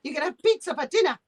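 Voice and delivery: high, sing-song mock of a careless mum; mocking the neglectful mother. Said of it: "and she's like".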